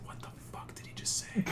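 bewildered whisper. Retold or imitated. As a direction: whisper it in disbelief. Retold confused inner reaction.

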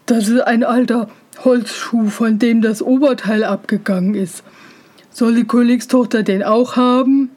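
put-on weary, disdainful older-brother voice; scornful brothers. A narrator's reading. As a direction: sigh at the fool, lightly in character. Brothers scorn the wooden shoe.

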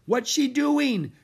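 high, loud, slow scared question; frightened cry. A narrator's reading. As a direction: cry out in alarm. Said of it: "cried Annie".

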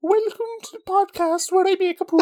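very high, squeaky Irish caricature; Irish accent pushed into falsetto; mock Irish accent. Shown as a demonstration. Co-host welcomes podcast listeners, squeaky Irish accent. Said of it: "What other accents can we do?".

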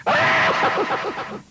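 high startled shout; shocked yelp. Retold or imitated. Tough kid recoiling at the finger, sharp high yelp. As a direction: jump back with a sharp cry. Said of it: "he goes".

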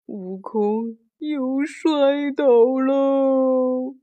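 drawn-out, sinking lament; slow woeful drawl. A narrator's reading. Storyteller as the monkey hero falls, slow woeful drawl. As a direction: stretch every word out slowly.

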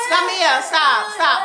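loud, high call to stop. Real, not acted. Mother calling her child to stop, loud call. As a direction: shout the name to halt her.

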